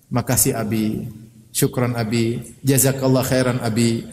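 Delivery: slow, low, tender thanks; grateful wife. Retold or imitated. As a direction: thank him slowly and gently. Grateful wife thanking her husband.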